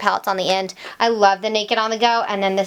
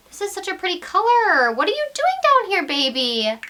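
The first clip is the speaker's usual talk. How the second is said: put-on high-pitched baby-talk coo; playful cooing; 9 semitones higher than usual. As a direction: coo at a lipstick like a long-lost pet. Cooing to a rediscovered lipstick.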